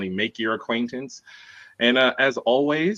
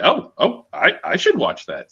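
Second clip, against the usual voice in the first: higher, piqued "oh, oh"; wife's sudden interest; 9 semitones higher, 5 dB louder than usual. Retold or imitated. Telling hosts how the wife reacted, higher-pitched imitation. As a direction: quote a wife perking up. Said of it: "She was like".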